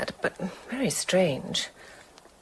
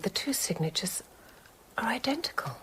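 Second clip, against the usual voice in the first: whispered, dropping away; a stunned hush of realization; horrified whisper; 3 semitones higher, 8 dB quieter than usual. Acted. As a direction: breathe the discovery out in dread. Shocked discovery of matching signatures.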